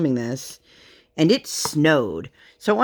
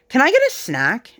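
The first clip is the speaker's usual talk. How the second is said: imitating her little girl; 7 semitones higher, 3 dB louder than usual. Mother voicing her small daughter.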